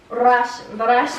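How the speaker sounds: hard Russian-accented English. Shown as a demonstration. Child performs on request, Russian accent. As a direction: launch into a forceful Russian accent. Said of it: "Ask her about her Russian accent".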